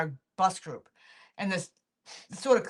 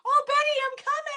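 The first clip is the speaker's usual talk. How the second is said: eager other woman. Retold or imitated. Other woman answering the clique's wave, eager high call. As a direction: imitate her calling out in a high voice. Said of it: "this other woman comes like".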